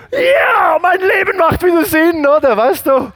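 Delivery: very high, loud shout of joy; elated outburst. Retold or imitated. Preacher finds his life meaningful, elated shouting. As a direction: exult at the top of your voice. Retold elation at the news.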